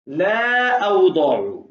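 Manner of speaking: ला अवदउ drawn out slowly; slow recitation. Shown as a demonstration. Teacher recites the verse word slowly for the student. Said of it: "लिखा क्या हुआ है".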